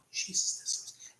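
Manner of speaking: slow, whispered amazement; hushed awe. Retold or imitated. Onlooker reacts to horses jumping, in slow whispered awe. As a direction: breathe it out under your voice, slowly.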